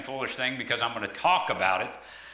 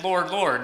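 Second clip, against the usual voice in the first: "Lord, Lord" pressed out, loud and raised, each "Lord" falling; emphatic Lord, Lord; 4 semitones higher, 3 dB louder than usual. Shown as a demonstration. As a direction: lean hard on both "Lord"s as a plea. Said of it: "and that was an emphasis".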